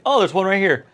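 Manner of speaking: light up as if you just spotted it; mock discovery. Teacher pretending to find a password note, mock surprise.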